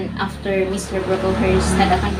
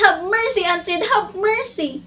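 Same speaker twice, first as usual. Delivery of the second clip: acted cry for mercy; crying out in distress; 12 semitones higher than usual. Jane begs Aunt Reed from the Red Room, pleading in distress. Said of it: "though I cried out while suffocating in distress".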